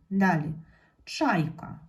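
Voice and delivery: ordinary delivery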